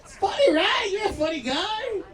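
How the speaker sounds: very high, jeering cop voice; gloating cop. Retold or imitated. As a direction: rub the prank in. Cop gloats over the prank.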